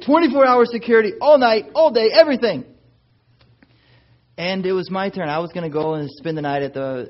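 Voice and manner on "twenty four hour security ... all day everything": loud, high command; firm order. Retold or imitated. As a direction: bark the order at the boys. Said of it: "now I said".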